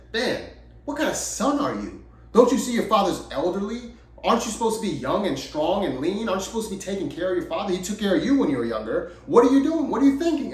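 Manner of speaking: high-pitched mock scolding, building to a loud peak; chastising traveler. Travelers scold the son about his father, chastising. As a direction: play an outraged stranger telling someone off, pitch climbing. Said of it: "they chastised the son".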